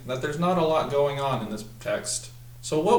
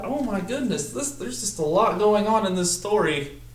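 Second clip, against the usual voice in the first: higher-pitched playful dismay; mock alarm; 8 semitones higher than usual. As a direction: act surprised for the class.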